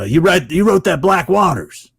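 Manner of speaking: high, loud actor impression; Robert Patrick. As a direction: call out to a half-remembered writer. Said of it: "He goes".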